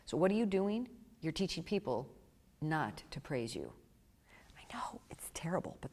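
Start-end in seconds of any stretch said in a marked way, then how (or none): 4.6-5.9 soft whispered confession; whispered aside. Real, not acted. Admitting to the audience in a whisper. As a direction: let them in on it under your breath. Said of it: "confiding aside".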